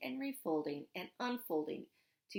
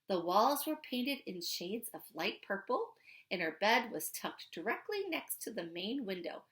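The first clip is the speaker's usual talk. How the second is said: ordinary delivery, nothing marked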